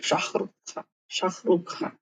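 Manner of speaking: शाहरुख खान repeated softly with a kh sound, the Russian way; soft Russian pronunciation. Shown as a demonstration. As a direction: quietly model शाहरुख खान Russian style. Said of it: "पर Russian में".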